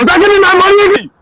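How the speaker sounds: high-pitched, indignant demand; insistent demand. Real, not acted. Customer demanding money back from the repairman, insistent.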